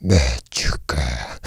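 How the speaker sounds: very low, slow croaked alien grunt; deep, soft monster voice; low big alien. Acted. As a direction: croak it slowly from the bottom of your range. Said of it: "it's gotta be low".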